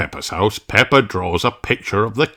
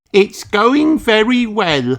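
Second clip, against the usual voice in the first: put-on higher child voice; Susie Sheep; 13 semitones higher, slower than usual. Susie answering Mummy Sheep, higher child voice. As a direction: answer brightly like a small girl. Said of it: "says Susie".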